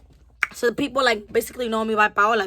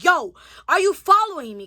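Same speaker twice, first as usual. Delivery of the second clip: raised, higher-pitched challenge; retold confrontation, fed up with a follower; aggravated; 5 semitones higher, 4 dB louder than usual. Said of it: "I just got aggravated as hell".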